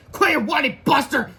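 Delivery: high, loud shout. Acted. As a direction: shout at the whining boys. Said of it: "shouted Miss Anthrop".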